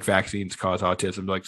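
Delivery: low, slow, hushed quote; quiet. Retold or imitated. Podcaster quoting people he finds annoying, quiet. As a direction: say it softly, as if unheard. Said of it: "Why are you saying it quiet".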